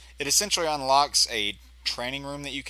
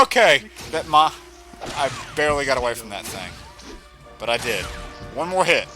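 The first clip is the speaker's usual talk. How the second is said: ordinary talk, nothing marked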